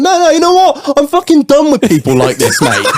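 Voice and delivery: high-pitched, worked-up protest; fed-up outburst, acted for the story; retold indignant rant. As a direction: re-enact refusing to calm down. Ranting back at his friend.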